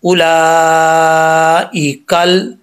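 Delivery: उलाइकल drawn out slowly, joined smoothly; slow tajweed recitation. Shown as a demonstration. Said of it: "बीच में अलिफ गिर जाएगा".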